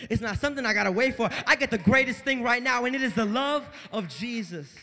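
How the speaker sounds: loud, driving sermon voice; impassioned preaching. Real, not acted. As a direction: proclaim it at full force. Preaches at full force.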